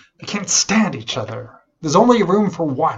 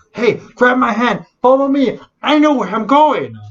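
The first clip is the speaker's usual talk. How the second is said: loud, high, eager call to follow; overconfident blind guide; 10 semitones higher, 7 dB louder than usual. Retold or imitated. Blind man urging another blind man to follow him, loudly confident. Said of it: "is blind people. And he's saying".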